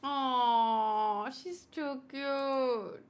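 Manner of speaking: ordinary talk, nothing marked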